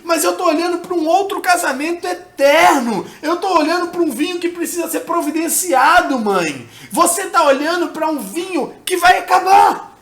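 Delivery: high, loud, impassioned appeal; preacher acting out an urgent plea; Jesus pleading with his mother. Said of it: "E Jesus tá falando assim".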